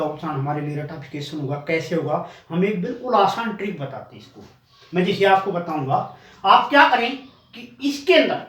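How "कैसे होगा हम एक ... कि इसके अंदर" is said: ordinary talk, nothing marked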